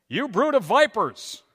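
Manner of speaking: loud, harsh, drawn-out prophet's denunciation; ironic thundering rebuke. Retold or imitated. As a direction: blast it out like a fiery prophet, nothing pastoral about it. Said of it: "speaking in his most pastoral voice".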